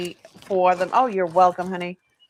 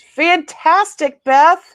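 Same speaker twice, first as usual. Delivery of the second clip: loud, drawn-out delighted cheer; excited cheer; 8 semitones higher, 11 dB louder, much slower than usual. Real, not acted. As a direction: cheer it out for her. Cheering a buyer's good news.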